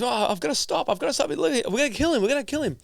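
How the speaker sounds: high-pitched frantic protest; acted panic. Participant protesting to the professor about shocks, acted panic. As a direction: imitate a distressed participant, pitched far up.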